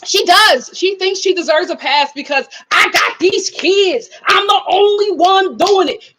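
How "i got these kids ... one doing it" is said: mock defiant whine. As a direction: parody her playing the victim. Mocking Sarah's excuse.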